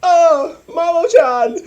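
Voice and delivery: high, loud lovestruck cry; swooning Usagi voice. Retold or imitated. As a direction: swoon over your boyfriend. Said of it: "Usagi is like".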